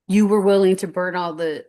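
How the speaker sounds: usual speaking voice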